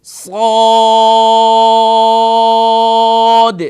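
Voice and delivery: صاد drawn out very long, full-mouthed and loud; tajweed practice, long madd. Shown as a demonstration. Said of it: "اس کی practice جاری رکھیں".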